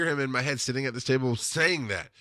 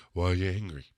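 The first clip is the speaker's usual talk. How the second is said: mocking the mentor; 4 semitones lower, 3 dB quieter than usual. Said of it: "I'm mocking him".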